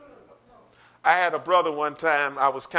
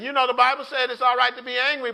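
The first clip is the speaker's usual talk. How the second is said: ordinary talk, nothing marked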